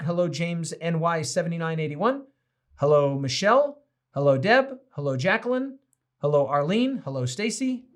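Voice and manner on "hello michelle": ordinary delivery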